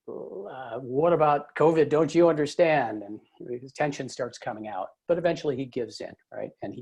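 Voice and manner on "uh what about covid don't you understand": exasperated dad. Retold or imitated. Dad scolding in retold family quarrel.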